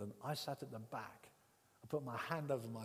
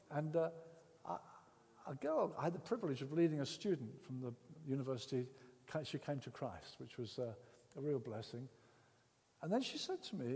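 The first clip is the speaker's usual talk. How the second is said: normal talking voice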